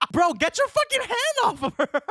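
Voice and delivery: half-yelling at the screen through laughter; laughing outburst. Real, not acted. Reacting to the train scene, laughing yell.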